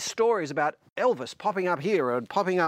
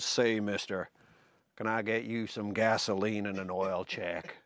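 lightly put-on lower Tupelo Southern drawl, a hint of [name]; a mild Tupelo drawl for the king pumping gas; 7 semitones lower, 5 dB quieter than usual. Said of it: "he said in a drawling Tupelo accent".

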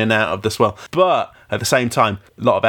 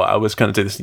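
ordinary talk

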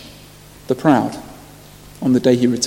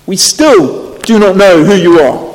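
stern abbot; 5 semitones higher, 6 dB louder, slower than usual. A narrator's reading. Abbot refuses the herald again.

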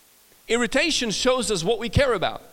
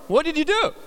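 mock disbelief; 9 semitones higher, 4 dB louder than usual. Kid accusing mom, astonished.

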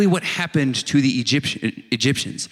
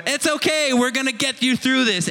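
higher, gently coaxing God voice; reassuring God; 8 semitones higher than usual. Retold or imitated. God reassuring a scared believer, lightly voiced. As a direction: comfort them up high.

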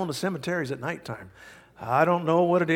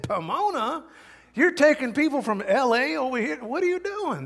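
incredulous; 9 semitones higher than usual. Retold or imitated. Policeman's incredulous questions.

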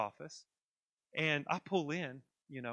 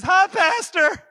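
high, loud, drawn-out mock hello; hollered greeting; 12 semitones higher, 15 dB louder, much slower than usual. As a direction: call out slowly like a passing driver. Imagined passerby calling out greeting.

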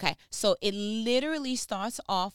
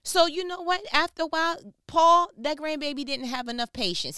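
higher, louder preaching build; preaching with fire; 6 semitones higher, 3 dB louder than usual. Real, not acted. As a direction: rouse the congregation.

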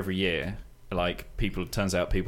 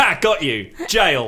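gloating; 8 semitones higher, 8 dB louder, slower than usual. Acted. Gloating gotcha.

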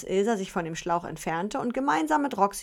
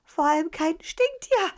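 blurted out loud; word slips out loud; too loud; 9 semitones higher, 5 dB louder than usual. Acted. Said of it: "etwas zu laut über die Lippen".

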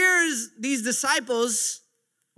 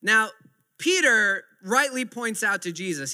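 ordinary talk, nothing marked

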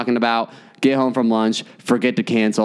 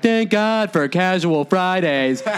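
higher, slow, savoring office voice; amused bank staff; 6 semitones higher, slower than usual. Retold or imitated. Branch staff enjoy the call, higher and drawn out. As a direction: relish the scandal like office gossip. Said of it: "everybody at the branch is like".

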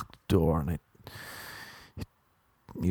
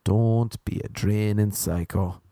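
slow, punched "Don't be a draining psycho"; slow emphatic plea; slower than usual. Shown as a demonstration. Comic to crowd, drilling his one request: slow, emphatic. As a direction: lean hard on "Don't", slowly. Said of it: "That's all I ask".

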